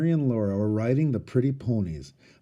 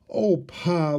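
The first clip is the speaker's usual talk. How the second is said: pleading; 5 semitones higher than usual. A narrator's reading. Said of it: "Laura pleaded".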